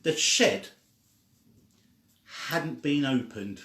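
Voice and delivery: ordinary delivery, nothing marked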